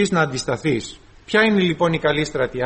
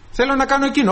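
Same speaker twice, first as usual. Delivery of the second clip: higher, wheedling demand; demanding flesh; 7 semitones higher, faster than usual. Acted. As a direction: nag like a spoiled child. Flesh demands, believer refuses.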